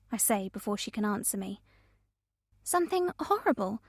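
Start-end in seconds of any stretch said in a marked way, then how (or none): 2.6-3.8 higher, softer, disbelieving echo; incredulous echo. A narrator's reading. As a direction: repeat her words back in disbelief. Friend echoes in disbelief.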